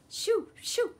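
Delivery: loud, very high shoo; sharp scarecrow cry to scare them off. A narrator's reading. Scarecrow shooing intruders away.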